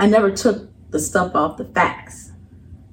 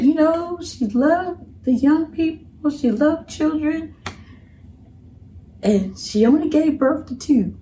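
eulogy line; 9 semitones higher than usual. Retold or imitated. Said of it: "'Cause I said".

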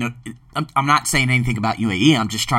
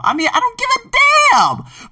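much higher and louder, slow defiant shout; 4 dB louder, slower than usual. Real, not acted. Host to listeners about racism, a slow defiant shout. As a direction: yell it out, slowly.

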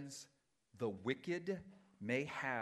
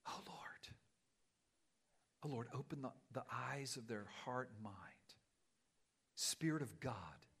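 whispered prayer. Real, not acted. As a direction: whisper it close to God.

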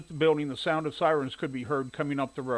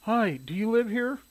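high-pitched, polite woman's query; inquiring woman; 9 semitones higher than usual. A narrator's reading. Shirley greeting the man in overalls, inquiring. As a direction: ask it in a raised, feminine-character pitch.

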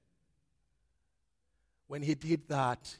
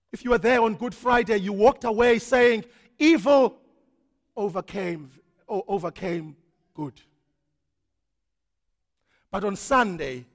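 quick, loud, rising pulpit delivery; fast full-force preaching; 5 semitones higher, 13 dB louder than usual. Acted. Preacher voicing Good Friday despair, fast and loud. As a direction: rush it, then land on evil.

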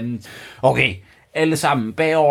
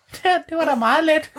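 much higher, quick squeaky vampire voice; Grev Bo's voice; faster than usual. Acted. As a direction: chirp it out like a relieved little vampire count. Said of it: "sagde Grev Bo".

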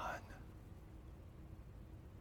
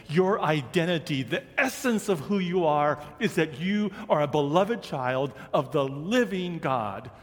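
higher, emphatic sermon delivery with punched key words; impassioned preaching; 6 semitones higher, 4 dB louder than usual. Real, not acted. Preacher telling the congregation who they are, impassioned. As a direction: preach it with rising fervour. Preacher building to full force.

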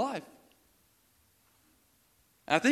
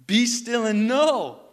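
slow, loud preached climax; 7 semitones higher, 10 dB louder, much slower than usual. Acted. Climax of the repeated verse.

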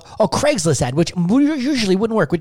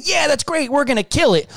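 mock eager; 9 semitones higher than usual. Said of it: "if you buy in and say".

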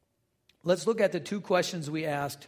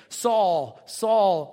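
voice from heaven; 6 dB louder than usual. Acted. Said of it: "I heard a voice saying to me in Aramaic".

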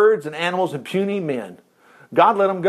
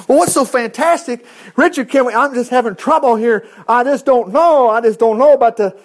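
higher and louder, preaching at full force; fervent preaching; 7 semitones higher, 6 dB louder than usual. Acted. Preacher to congregation, fervent full-force preaching. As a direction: drive it home to the congregation.

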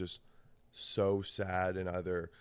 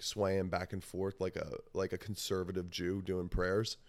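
normal talking voice

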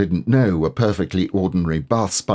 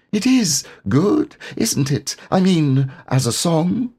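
Piglet, careless; 10 semitones higher than usual. Acted. Piglet fishing for praise about Pooh's song, careless and high. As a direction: toss it off as if it hardly matters. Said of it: "said carelessly".

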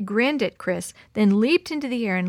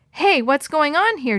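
loud, higher alarmed call; 6 semitones higher, 6 dB louder than usual. A narrator's reading. Jim pulls the lion away from Chris, calling out. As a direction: call out sharply to intervene. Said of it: "Jim called".

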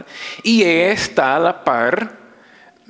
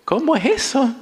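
puzzled surprise; 12 semitones higher than usual. Retold or imitated. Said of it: "la gente decía".

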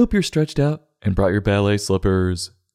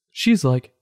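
normal talking voice

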